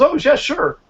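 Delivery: imitating a guy agreeing happily; eager guy agreeing. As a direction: play the willing stranger, happy to help. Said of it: "And the guy goes".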